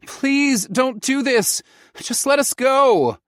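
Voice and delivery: high, loud, slow plea; begging. A narrator's reading. Son begging his father to let them go. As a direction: plead with everything you have. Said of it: "I begged".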